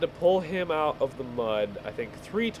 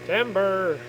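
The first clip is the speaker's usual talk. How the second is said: higher, louder shout of timber; playful timber call; 8 semitones higher, 5 dB louder than usual. Pretend. Logger calling out as the tree falls, playful shout.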